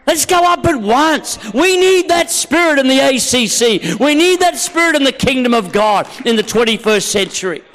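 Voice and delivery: loud, high, forceful exhortation; rallying preaching. Real, not acted. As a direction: fire up the congregation.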